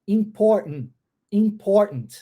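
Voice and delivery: "Important" with the middle T swallowed, sounding like "impor". Shown as a demonstration. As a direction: gulp the T after "impor" in "Important". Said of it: "Eu engulo esse T". ("Important" engolindo o T, soando "impor")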